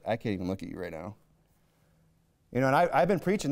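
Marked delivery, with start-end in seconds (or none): 0.0-1.2 low, fast, mildly stern God voice; a hint of a God too angry to look. Preacher voices God turning away from sinners, low and quick. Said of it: "he's just like".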